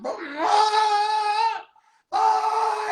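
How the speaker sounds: high, sudden mock screams from the crowd; imitated screaming. Retelling the jail crowd's screams, high mock screams. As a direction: yell it like the whole precinct went wild. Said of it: "Everybody screaming".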